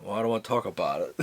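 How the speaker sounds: low, quick, soft brush-off; mock evasive reply. Child dodging a question about dad's job, evasive. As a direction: dodge the question, dropping your voice.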